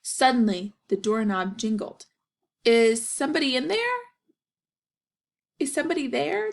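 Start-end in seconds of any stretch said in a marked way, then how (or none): 2.6-6.5 higher, calling out; shouting. Acted. Gilbert calling through the locked door, higher. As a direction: call out for help. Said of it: "Gilbert shouted".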